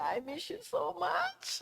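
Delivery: high, hushed, tearful whisper of longing; a soft, tear-choked plea; tearful retold words. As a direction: breathe it softly as if crying again. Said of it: "I started crying and I said".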